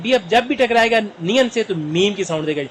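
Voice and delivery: usual speaking voice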